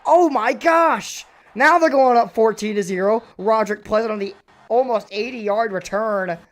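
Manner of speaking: ordinary delivery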